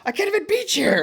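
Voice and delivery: Ken outburst. Retold or imitated. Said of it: "him going like".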